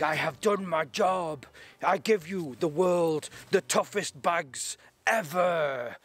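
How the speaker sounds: slow, solemn mock Finnish accent; the old hero hands over the world, slowly, in a hammed Finnish accent; terrible Finnish accent. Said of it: "my terrible Finnish accent".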